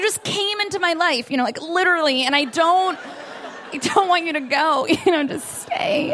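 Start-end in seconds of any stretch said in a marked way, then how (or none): 2.4-6.1 slow pleading. Retold or imitated. Pleading lover clinging.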